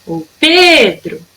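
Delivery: calling out, stretched first syllable of Pedro; chamando de longe. Shown as a demonstration. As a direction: call Pedro from outside his house. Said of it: "eu vou gritar". (chamando o Pedro na porta, sílaba esticada)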